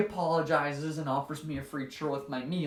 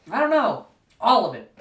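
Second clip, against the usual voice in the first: exasperated outburst; 3 semitones higher, 9 dB louder than usual. Retold or imitated. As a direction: throw up your hands and blurt it. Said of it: "I have to be like".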